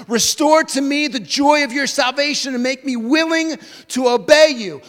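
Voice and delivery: high, loud pleading prayer; preaching a prayer at full force; impassioned prayer. Acted. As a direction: pour out the psalm with fervor. Preacher voices Psalm prayer.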